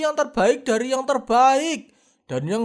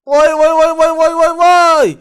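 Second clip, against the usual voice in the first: loud hawker's shout, higher; calling out; 4 semitones higher, 10 dB louder than usual. Acted. Cow seller shouts at online buyers to draw them in, loud call. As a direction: yell to grab the crowd's attention.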